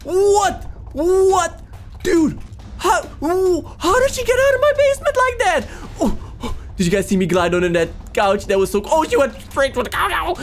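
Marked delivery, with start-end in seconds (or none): 0.0-1.6 high, drawn-out repeated whats; stunned high exclamations; shocked disbelief. Pretend. Stunned by her sudden appearance. 2.0-5.7 high-pitched, alarmed disbelief; wailing, astonished question; incredulous outburst. Pretend. Shocked she escaped the basement.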